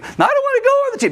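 put-on very high, fast child's whine; whiny child voice. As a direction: whine like a stubborn kid. Kid refusing the tube.

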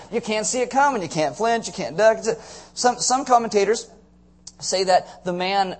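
ordinary delivery

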